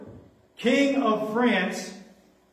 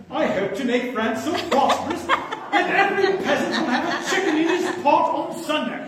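higher, hammed-up English-accented royal quote; mock English accent; 7 semitones higher, 4 dB louder than usual. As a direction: play an English king for laughs. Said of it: "Henry the Fourth is English".

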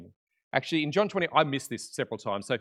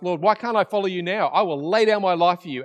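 raised, louder, insistent plea; impassioned pledge; 5 semitones higher, 7 dB louder than usual. Retold or imitated. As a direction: protest your devotion with force. Acting Peter's insistent pledge to Jesus.

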